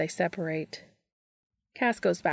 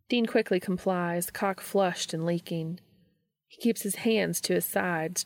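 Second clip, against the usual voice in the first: ordinary delivery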